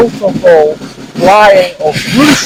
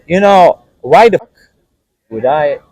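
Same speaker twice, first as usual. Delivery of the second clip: ordinary talk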